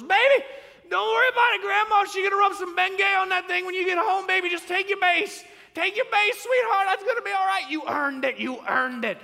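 high, loud hammed-up grandma holler; over-the-top imitation of a doting grandmother yelling; screaming grandmother. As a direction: scream comfort through the fence like a frantic grandma. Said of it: "She's screaming at him".

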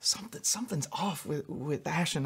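hushed unvoiced whisper. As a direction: whisper it so the daughter can't hear. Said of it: "Brooke nudged me and she said".

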